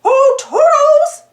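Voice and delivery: high, slow, loud sing-song call; yelling. Acted. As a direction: summon the helper in a hurry. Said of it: "yelled Mickey".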